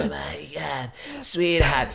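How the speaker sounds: slow, husky diva drawl; a light Cruella voice; Cruella de Vil. Pretend. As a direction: purr it like a cartoon villainess. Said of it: "She goes".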